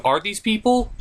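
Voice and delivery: normal talking voice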